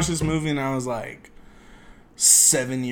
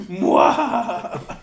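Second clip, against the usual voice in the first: ghoulish voice; 5 semitones higher, 4 dB louder than usual. Acted. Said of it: "your normal voice".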